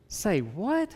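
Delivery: sharply rising, disbelieving question; incredulous response. Acted. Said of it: "That's what Jesus is doing".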